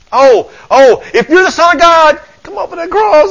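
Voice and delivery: high, loud, desperate wail; panicked devil. Acted. Devil begging Jesus off the cross, in acted panic. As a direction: cry it out as a hammed-up panicking devil. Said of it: "I can hear the panic in the devil's voice".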